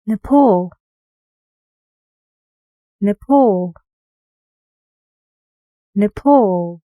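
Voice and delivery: "Nepal" with a loud, stressed "pal"; stressed second syllable. Shown as a demonstration. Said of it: "guess which syllable is stressed".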